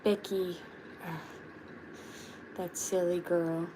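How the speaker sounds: lightly voiced turtle with a mock exasperated sigh; understated fond grumble about Becky; a hint of a turtle tutting over a friend; Chip voice. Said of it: "I did the Chip voice".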